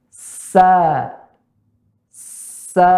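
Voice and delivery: SA with a long, light hissed S and open A; demonstrated sa. Shown as a demonstration. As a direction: draw out "Sa", thin and open. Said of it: "Kami contohkan".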